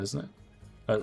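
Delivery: normal talking voice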